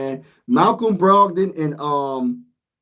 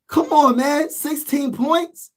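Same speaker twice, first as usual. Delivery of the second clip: exasperated; 8 semitones higher, slower than usual. Real, not acted. Disbelief at Harden's game.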